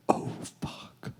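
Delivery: breathless whispered curse; whispered dread. Retold or imitated. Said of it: "So it's like".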